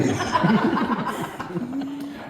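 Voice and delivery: high rising laughter; a long high laugh. Real, not acted. Laughing at granddad's answer.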